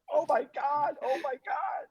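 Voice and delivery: high-pitched mock panic; retold panic. Man and girl staring at each other, retold panic. Acting out both of them panicking.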